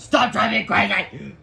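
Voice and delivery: loud, high, drawn-out scolding; strict teacher losing patience; exasperated teacher's order. Acted. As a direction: lay down the law, each word stretched and loud. Teacher's scolding rule acted out.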